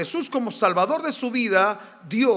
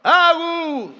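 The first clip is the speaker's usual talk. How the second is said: put-on higher and louder, calling the kids' names; shouting names; 9 semitones higher, 11 dB louder, slower than usual. Father shouting his sons' names before a scolding. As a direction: yell the boys' names to scold them. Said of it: "Y yo gritaba".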